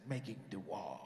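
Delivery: very low, solemn repeat. Retold or imitated. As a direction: intone it deep and weighty. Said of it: "That's the way I said it".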